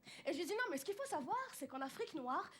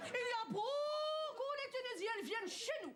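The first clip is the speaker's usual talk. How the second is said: higher, forceful Algerian accent; 6 semitones higher than usual. Shown as a demonstration. A comedian demonstrates how Algerians talk, virulent Algerian accent. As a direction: punch it out like a fiery Algerian. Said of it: "ils sont un petit peu plus virulents".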